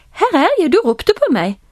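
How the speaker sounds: put-on eager young servant reporting in; boy answering a call. Boy comes to priest Eli a third time, high eager reply. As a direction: leap high on the first words, then come down.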